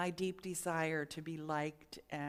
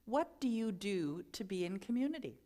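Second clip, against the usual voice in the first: normal talking voice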